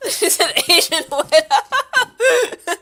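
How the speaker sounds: high, loud, slowed by laughter; laughing uncontrollably. Real, not acted. Streamer repeating chat's joke, cracking up. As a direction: squeal the words out through real laughter.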